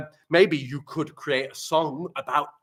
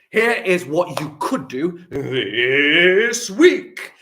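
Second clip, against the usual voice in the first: louder, very slow, big announcement; grand announcement of the week's task; announcer voice; 5 semitones higher, 8 dB louder, much slower than usual. Acted. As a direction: boom it like a show host.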